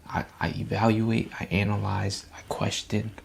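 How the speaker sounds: usual speaking voice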